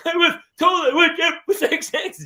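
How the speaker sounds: put-on high, loud wailing kid voice; hammed-up high sobbing complaint; imitating sobbing child. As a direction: wail it like a heartbroken little girl. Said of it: "she was beside".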